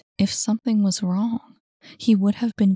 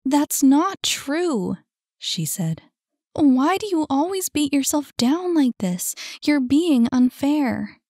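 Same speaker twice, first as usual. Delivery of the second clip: put-on higher upset young woman voice; higher pleading Saphira; 7 semitones higher, 4 dB louder than usual. A narrator's reading. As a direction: plead with him, lifted voice. Saphira protests.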